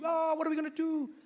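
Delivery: flustered delegates; mock panic. United Nations delegates panicking over the bomb threat, mock panic. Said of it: "They'd be".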